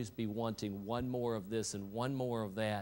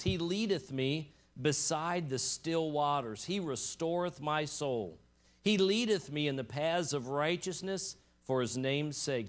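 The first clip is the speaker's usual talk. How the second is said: solemn scripture reading; 5 semitones higher, 4 dB louder than usual. Acted. Preacher reading the Psalm to his congregation, declamatory. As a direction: proclaim each verse with weight, letting the phrases fall.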